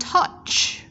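TOUCH ending in a clear CH; CH sound. Shown as a demonstration. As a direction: release a strong CH ending "touch". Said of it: "là âm ch".

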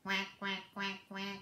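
soft low quacks, a touch of a duck; mother duck quacking. Acted. Mother duck calling her ducklings, soft low quacks. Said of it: "Mother duck said".

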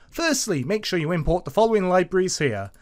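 normal talking voice

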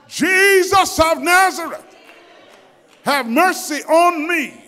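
loud desperate cry for mercy; shouting. A narrator's reading. Blind man shouting to Jesus over the crowd, loud plea. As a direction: call out over the crowd with all his might. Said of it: "he begins shouting with all his might".

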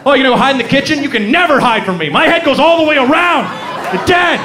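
rising, high-pitched owl menace; taunting threat. Acted. Owl to owner hiding in kitchen, taunting and menacing. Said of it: "he's just taunting you".

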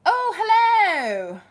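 high, slow, drawn-out hello; excited greeting. Real, not acted. Streamer greeting a viewer in chat, delighted and drawn out.

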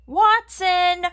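high, loud scolding call; shouting the dog's name. Acted. Family catches the dog, loud scolding shout.

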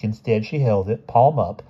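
ordinary delivery

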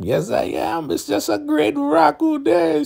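very high, rising mock delight; gleeful churchy lady. Marva gloating to the other Elder Mother, high gleeful. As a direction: gush with glee. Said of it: "she's like".

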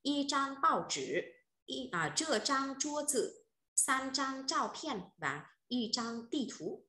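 high, slow Chinese reading of "Y trang bao chữ"; slow model reading. Shown as a demonstration. Teacher rereads all the phrases for the class, slow and high. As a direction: read each phrase slowly and clearly, syllable by syllable, like a model for students. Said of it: "cô đọc lại một lần nhá".

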